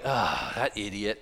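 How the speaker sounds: low, slow mock exasperation; exasperated. Preacher voices Satan fed up with his prayers, exasperated. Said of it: "he's all exasperated".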